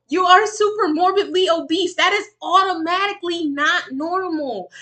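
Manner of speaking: high, loud, forceful rebuke; exasperated. Real, not acted. Streamer arguing with the woman on screen, exasperated. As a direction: lay down the fact loudly, fed up.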